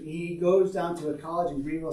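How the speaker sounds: usual speaking voice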